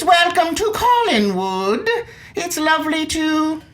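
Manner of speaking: slow, high mock drawing-room Downton Abbey accent. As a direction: parody the actors' strained Downton Abbey English. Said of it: "drawing room Downton Abbey sort of accent".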